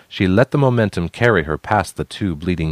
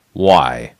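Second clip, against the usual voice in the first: lower, louder hard question; hardened voice; 4 semitones lower, 5 dB louder than usual. A narrator's reading. Big man confronting her, a lower, louder hardened why. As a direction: harden it with disappointment. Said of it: "His voice hardened".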